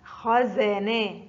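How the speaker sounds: خزانا said very slowly, each sound clear; very slow classroom model of خزانا; slow example word. Shown as a demonstration. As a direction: draw out خزانا for the pupils. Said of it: "زا في وسط الكلمة".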